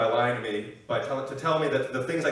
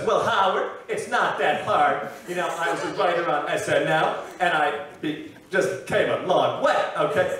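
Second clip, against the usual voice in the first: John Mulaney; 3 semitones higher than usual. Acted. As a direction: answer the host in stretched stand-up cadence. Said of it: "I'm gonna simulate it for you".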